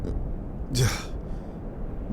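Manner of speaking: a soft, long unvoiced sigh. Unsure how to help her, the doctor sighs. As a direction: let out a weary sigh.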